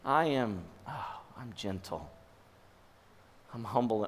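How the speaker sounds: very soft, low, with a tender "oh"; gentle and humble. Acted. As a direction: speak it low, as comfort. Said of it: "Can you imagine Jesus saying that".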